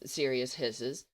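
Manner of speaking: usual speaking voice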